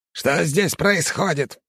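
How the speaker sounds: slow stern angry teacher. A narrator's reading. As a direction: demand answers slowly and sternly. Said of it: "выругался он".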